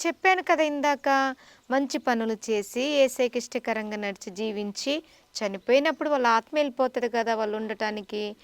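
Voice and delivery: ordinary talk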